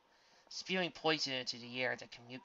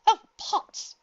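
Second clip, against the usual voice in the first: relieved; 15 dB louder than usual. A narrator's reading. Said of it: "said with a sigh of relief".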